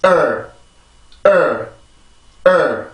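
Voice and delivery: 을 as a stressed English "er", not a Korean L; tense the throat, then release 을 as "er"; isolated "er" with a firm onset; stressed r-colored schwa. Shown as a demonstration. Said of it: "이렇게 강세를 만들어줍니다".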